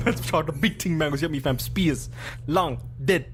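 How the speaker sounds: hammed-up London roadman voice; lightly put-on roadman slang; London roadman accent. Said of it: "my Roadman voice".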